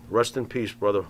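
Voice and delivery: ordinary talk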